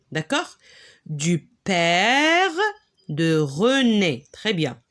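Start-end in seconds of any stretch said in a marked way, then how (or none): 1.1-4.3 "Du père de René" read slowly, syllable by syllable; slow model reading. Shown as a demonstration. Teacher rereading the sentence for pupils, slowly. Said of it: "Regardez là".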